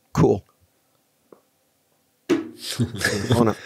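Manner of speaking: a dry unvoiced whisper of indifference; whispered cool. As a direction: shrug it off in a whisper. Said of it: "I literally went like this".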